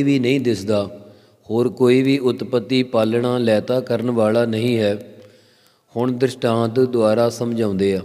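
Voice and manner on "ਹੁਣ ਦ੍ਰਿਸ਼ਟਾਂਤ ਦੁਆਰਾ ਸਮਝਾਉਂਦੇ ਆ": usual speaking voice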